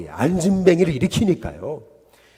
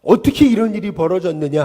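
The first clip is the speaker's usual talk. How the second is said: mock amazement, rising high and loud; retold astonishment; 5 semitones higher, 4 dB louder than usual. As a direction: imitate a stunned onlooker crying out. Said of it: "사람들이 놀라게 됐다라고 하는 얘기죠".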